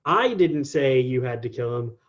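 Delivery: emphasize I, then let the rest fall away; stress on I. Shown as a demonstration. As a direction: lean hard on the opening word I. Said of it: "put an emphasis on each individual word".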